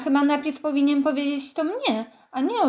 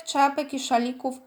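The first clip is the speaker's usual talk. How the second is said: ordinary delivery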